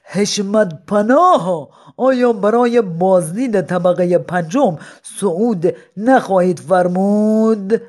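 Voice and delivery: slow courtly address. Acted. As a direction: draw out the honorifics. Old man flatters madman.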